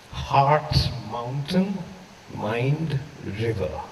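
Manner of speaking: slow, drawn-out refrain with pauses; slow poetic refrain, the poem's recurring line. Acted. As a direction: let each word hang, slowly. Refrain read slowly.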